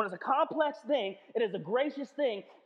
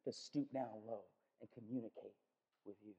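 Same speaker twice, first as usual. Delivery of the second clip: patient and gentle; 12 semitones lower, 12 dB quieter, faster than usual. Retold or imitated. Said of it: "He goes".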